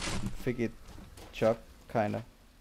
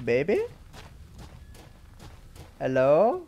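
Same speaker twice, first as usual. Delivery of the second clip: raised, questioning call; 4 semitones higher, 5 dB louder than usual. Real, not acted. Player calls to a baby in the maze, calling out. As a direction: call out louder, with a rising question.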